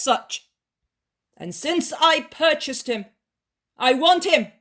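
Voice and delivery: stern demand. Acted.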